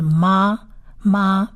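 slow "妈", m joined to a long "ah"; drawn-out "妈" as a syllable demo. Shown as a demonstration. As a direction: sound out "妈" slowly, then repeat it. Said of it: "它回来发长啊的音".

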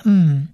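"嗯" as a closed-mouth nasal hum; nasal sound. Shown as a demonstration. Teacher showing a word-final mem, nasal sound. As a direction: hum "嗯" through the nose. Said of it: "是发鼻音的".

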